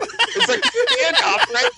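high, squeaky with laughter; helpless laughter through the words; laughing. Real, not acted. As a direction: barely get it out for laughing. Cracking up at own story.

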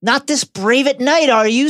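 high sneer. Retold or imitated. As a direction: sneer it at him. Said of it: "sneered Dudley".

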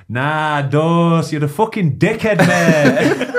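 loud, hammed-up northern outburst; broad northern accent. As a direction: imitate a fuming mate in broad northern. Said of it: "in his broad northern accent".

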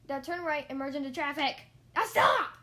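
loud command building to a yell; urgent shouted orders. A narrator's reading. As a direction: bark directions, then scream stop. Instructor shouting urgent driving orders.

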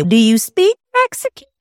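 put-on high-pitched, quick kid question; mimicked schoolkid. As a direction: imitate a nosy third grader. Schoolchildren questioning her, voiced.

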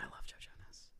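breathy whispered confession. Real, not acted. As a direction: sigh it out in a whisper.

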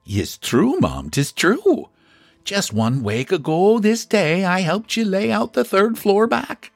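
put-on high Irish-accented neighbour; a nosy Irish neighbour, impressed; Irish gossip voice. Mrs McCool speaks.

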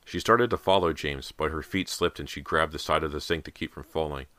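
ordinary delivery

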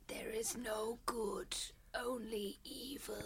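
hissed villain whisper; Voldemort whisper. Pretend. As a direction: whisper it like a dark lord. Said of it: "give me a line from the left".

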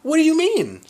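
high, loud disbelieving question; retold shocked cry.

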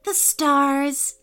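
put-on cheerful, high child voice; light, happy girl voice; happy little girl. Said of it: "said Poppy happily".

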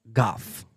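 "Goth" said alone with no L; pronunciation demo. Shown as a demonstration. Repeating chat's complaint, "Goth" said without L. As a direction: say "Goth" as an isolated specimen. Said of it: "You say goth like my grandma".

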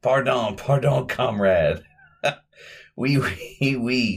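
lightly imitated bad French accent that slips toward Russian; a hammed-up, half-hearted French accent; terrible French accent. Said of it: "does a terrible accent".

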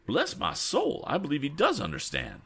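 very high-pitched astonishment; high exclamation of wonder; amazed cry. A narrator's reading. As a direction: marvel in a high, excitable voice. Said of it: "cried Mister Damon".

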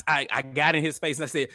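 ordinary delivery

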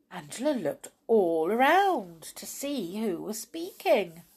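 ordinary delivery, nothing marked

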